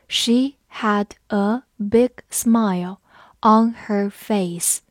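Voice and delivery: slow and separated, word by word; slow it right down for learners to repeat; slow read-along. Shown as a demonstration. Teacher asks learners to read along, slow reading. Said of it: "跟着我慢读一遍".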